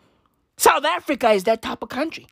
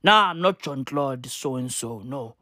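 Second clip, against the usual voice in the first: low, soft cowardly mumble; scared soldier voice; 9 semitones lower, 3 dB quieter than usual. Acted. Soldier refusing duty, low timid mumble. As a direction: play a reluctant soldier backing out.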